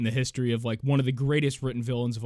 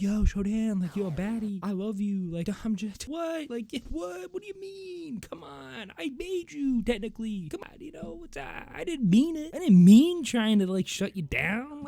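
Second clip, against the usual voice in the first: high, pleading mock voice, much higher; lovesick Diego; 10 semitones higher, 3 dB louder than usual. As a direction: whine up high like a smitten fool. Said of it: "he's like".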